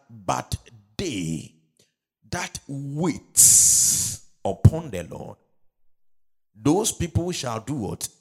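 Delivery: slow, emphatic quoting of scripture; forceful preaching. Real, not acted. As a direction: stretch the key words and ring them out.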